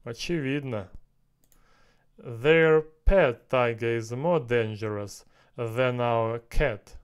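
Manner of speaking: ordinary talk, nothing marked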